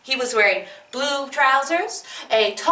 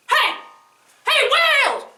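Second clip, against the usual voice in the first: shouting; 11 semitones higher than usual. Acted. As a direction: yell up from inside the belly. Man shouts inside whale.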